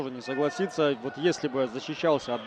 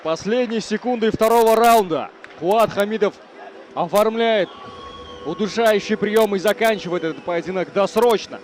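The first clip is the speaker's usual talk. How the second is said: loud, raised live play-by-play; wrestling commentator; 3 semitones higher, 6 dB louder than usual. Real, not acted. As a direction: boom out the result like a ringside caller. Wrap-up call after the finish.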